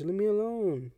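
normal talking voice

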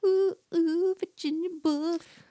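high, drawn-out swoon on the name. Pretend. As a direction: gush over her name like a fan. Swooning over the author.

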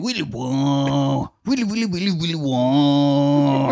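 high mouth-made guitar licks, long wailing end; imitated guitar solo, quick runs into drawn-out wails; air guitar noises. [name] air guitaring on his couch, imitated guitar noises. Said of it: "like, air guitaring".

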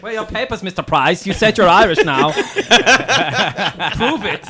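hammed-up creepy Santa demanding papers; lightly played sinister official. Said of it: "creepy Santa's coming back".

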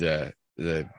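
ordinary talk, nothing marked

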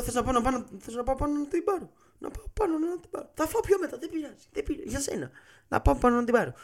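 high, nervous pleading voice; scared tourist begging to fetch the card; panicky pleading. Retold or imitated. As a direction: talk fast and high like you're terrified of the mafia.

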